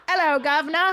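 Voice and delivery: high, hammed-up cockney hello; fake English accent. Wife imagining husband greeting the king in a high mock accent. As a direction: squeak it out like a silly Brit. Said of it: "will go".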